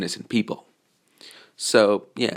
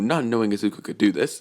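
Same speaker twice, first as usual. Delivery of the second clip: usual speaking voice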